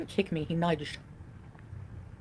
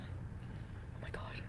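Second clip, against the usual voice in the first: whispered; 19 dB quieter, much slower than usual. Real, not acted. Aside reacting to chat.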